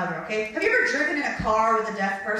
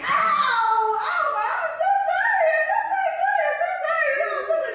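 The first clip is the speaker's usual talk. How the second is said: hammed-up high fake sobbing apology; fake crying; 4 dB louder than usual. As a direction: wail sorry like a kid faking tears. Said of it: "Just cry, cry, cry".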